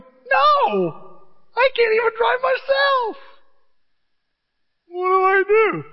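very high, loud exaggerated squawk; mock frantic yell. Preacher refusing to drive for brother, mock frantic shout. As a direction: cry out in hammed-up helplessness.

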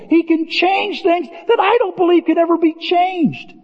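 climbing preacher's roar; full-force preaching. Acted. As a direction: preach it hard from the pulpit. Builds into fervent preaching.

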